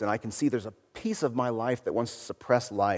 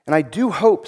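a slightly higher, lightly tremulous plea; Lucy pleading gently, barely shaking; 7 semitones higher, 6 dB louder than usual. A narrator's reading. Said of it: "said Lucy in a tremulous voice".

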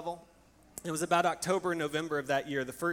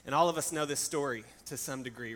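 ordinary talk

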